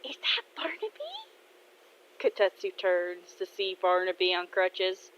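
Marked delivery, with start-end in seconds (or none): 0.0-0.9 high, softer thrill of recognition; beaming. Acted. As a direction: spot a favorite person with hushed delight. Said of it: "Kaede beams and says".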